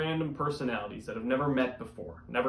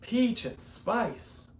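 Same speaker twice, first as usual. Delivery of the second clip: drawn-out phrase; 6 semitones higher, much slower than usual. Acted.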